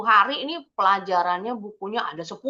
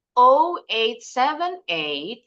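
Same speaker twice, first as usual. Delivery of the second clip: slow, louder "Oh eight seven eight", lifted at the end; rising intonation; 4 semitones higher, 5 dB louder, much slower than usual. Shown as a demonstration. Teacher showing students how to say a phone number, rising intonation. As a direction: read "Oh eight seven eight" slowly, voice going up on the final "eight". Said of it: "intonasinya harus naik".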